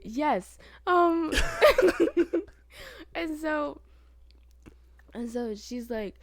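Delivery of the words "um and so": laughing. Real, not acted. Laughs at the gross story.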